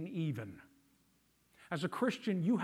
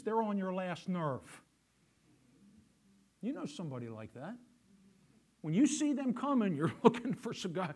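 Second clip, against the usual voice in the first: normal talking voice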